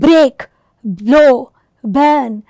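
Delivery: "break, blow, burn" as low, equal hammer beats; spondaic stresses. Shown as a demonstration. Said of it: "you here find stressed sounds repeated".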